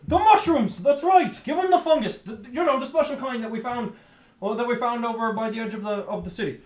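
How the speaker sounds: high, loud excited character voice; excited villager. Acted. Urging someone to give him the mushrooms, excited. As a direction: pitch it up and rattle it out eagerly.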